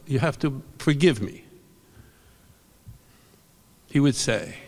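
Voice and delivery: plain reading voice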